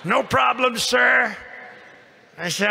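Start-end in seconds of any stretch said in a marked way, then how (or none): 0.0-1.4 loud, high "no problem, sir"; retold captain's reply. Captain reassuring the president, loud and high. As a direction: call it out brightly over the noise.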